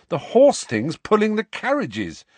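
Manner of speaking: impatient. Acted. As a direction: push it out impatiently. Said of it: "said Harry impatiently".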